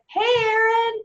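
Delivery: mock her breezy "hey" like old friends; the rep's too-casual hello, imitated; casual caller impression. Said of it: "it was sort of like".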